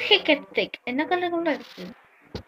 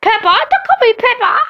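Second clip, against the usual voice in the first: louder, higher protest; upset shout; 8 semitones higher, 5 dB louder, slower than usual. Real, not acted. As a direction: yell at the game. Protesting the game's pick.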